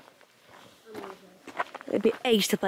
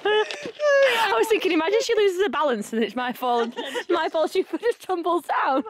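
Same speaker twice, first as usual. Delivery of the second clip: high-pitched words breaking into laughter; laughing hard; 10 semitones higher than usual. Real, not acted. Explaining fear her friend tumbles, laughing hard. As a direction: try to explain through the giggles.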